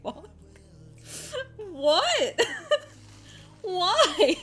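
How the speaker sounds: retelling herself half laughing, half crying; giggly teary disbelief, high and loud; laughing quote. Retold or imitated. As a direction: laugh through a teary what. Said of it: "and I was like".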